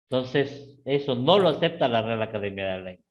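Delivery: ordinary talk, nothing marked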